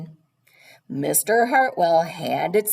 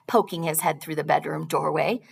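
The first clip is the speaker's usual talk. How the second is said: ordinary delivery, nothing marked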